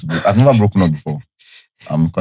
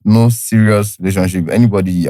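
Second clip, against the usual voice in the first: ordinary talk, nothing marked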